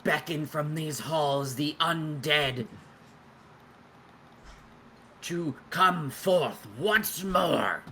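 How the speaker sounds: slow, high menacing incantation; ominous summoner. Acted. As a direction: intone it like a dark ritual. Undead summoner calls forth dead.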